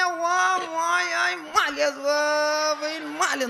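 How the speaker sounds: high, loud crying wail; wailing lament. Retold or imitated. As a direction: cry out over the body, drawn out. Sister wails over her brother. (उंच, मोठ्याने रडका आक्रोश)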